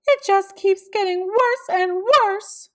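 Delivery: high, loud tearful wail; on the edge of sobbing; a mother's tearful lament; near sobbing. A narrator's reading. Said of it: "She began to sob".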